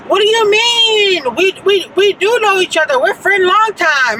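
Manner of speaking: high, insistent mock protest of friendship; imitating an African man. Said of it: "he was an African".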